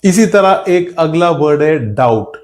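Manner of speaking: ordinary talk, nothing marked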